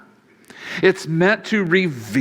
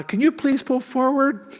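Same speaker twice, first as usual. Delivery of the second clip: much higher put-on voice of a polite cashier; drive-thru worker; 12 semitones higher than usual. Drive-thru worker asking the customer to pull forward, high and chirpy. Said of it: "they say".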